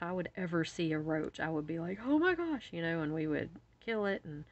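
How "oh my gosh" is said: high, louder mock-horrified exclamation; high-pitched "imagine my horror" reenactment; retold freak-out. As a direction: act out panicking at a roach sighting. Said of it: "I flipped out".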